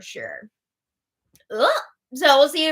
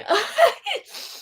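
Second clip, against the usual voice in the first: high giggle. Real, not acted. Laughs at own yawn.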